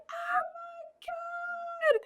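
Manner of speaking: very high, drawn-out wail; mock panic. Telling how the bat sent her under sheets, mock panic. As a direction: stretch it into a squealed mock wail.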